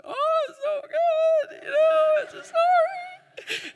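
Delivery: very high, strained, slow hammed-up weeping voice; mock crying. Dad imitating himself crying over his son's prayer, very high and strained. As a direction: overdo a choked-up sob of joy. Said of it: "that's what I look like when I cry".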